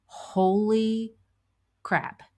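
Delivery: drawn-out mock dismay, high then dropping; retold dismay. Manager facing the flooded basement, retold dismay. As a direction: stretch it out as a retold stunned reaction.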